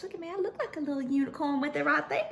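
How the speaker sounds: ordinary delivery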